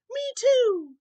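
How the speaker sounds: put-on high, loud little-kid chirp; tiny joey voice. A narrator's reading. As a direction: pipe up like a small child. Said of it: "the young kangaroo in her pouch said".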